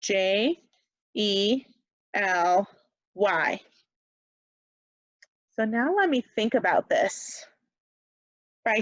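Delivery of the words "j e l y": usual speaking voice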